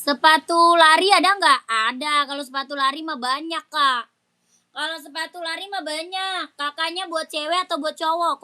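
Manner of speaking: loud, brisk live-stream seller pitch; live sales call. Acted. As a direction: hustle viewers to comment before the break. Live seller calling viewers. (seruan penjual live yang lantang)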